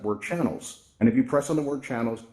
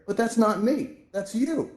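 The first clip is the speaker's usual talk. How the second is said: much higher, puzzled discovery; acted realisation; 10 semitones higher, slower than usual. User hearing own voice from another's avatar, puzzled realisation. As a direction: play someone working out an odd echo.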